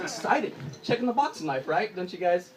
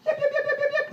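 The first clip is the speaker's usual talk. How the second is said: mock party yelps; faster than usual. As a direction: squeal yep over and over. Other side of party.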